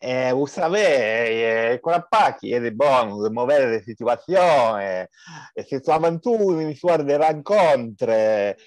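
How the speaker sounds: put-on a light Spanish accent drifting toward Italian. Friend reads the famous quote in a light Spanish accent. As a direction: play the quote with a hammed-up Spanish lilt. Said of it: "J'ai essayé l'accent espagnol".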